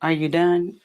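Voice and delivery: low, hushed deadpan imitation; unimpressed son. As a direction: voice a teen who is over it. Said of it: "he's looking at me like".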